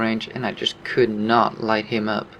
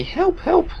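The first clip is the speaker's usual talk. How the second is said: mock scream. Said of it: "screaming at me".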